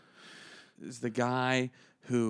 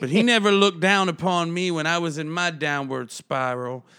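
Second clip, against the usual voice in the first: raised-voice self-pitying toast; buzzed depressive best man; 4 semitones higher, 4 dB louder than usual. Retold or imitated. Buzzed best man telling guests about his brother, loud and self-pitying. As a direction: imitate a boozy, wounded speechmaker. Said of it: "he's a little buzzed up, he's depressive".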